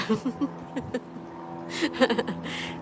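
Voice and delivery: airy laugh. Real, not acted. Laughing at a viewer's joke about winning at dawn.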